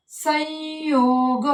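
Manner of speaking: संयोग stretched very slowly, the य heard clearly; slow demo pronunciation. Shown as a demonstration. Teacher demonstrating the य sound, very slow. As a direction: draw out संयोग so the य comes through. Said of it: "याचा उच्चार होतोय पहा".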